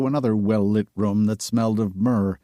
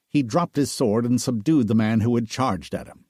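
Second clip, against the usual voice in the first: usual speaking voice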